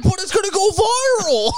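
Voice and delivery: very high, exaggerated whine; mock pleading squeal. Boys defending their video to the girls, mock high pleading. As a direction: plead it in a comic falsetto.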